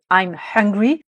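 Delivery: HUNGRY with a clearly breathed H at the start; H bien prononcé. Shown as a demonstration. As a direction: sound the H of HUNGRY distinctly. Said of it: "où là on prononce bien le H". (« hungry » avec le H initial bien prononcé)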